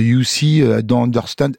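put-on English with a French accent; halting English, thick with French. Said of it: "J'ai l'accent français". (anglais avec l'accent français)

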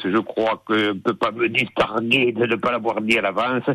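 ordinary talk

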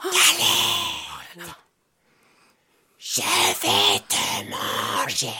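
low, slow wolf growl. Acted. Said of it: "dit le loup".

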